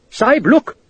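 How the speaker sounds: high, pressing cry; urgent alarm. A narrator's reading. Gopal pointing Dodd westwards, urgent cry. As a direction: make him look right now.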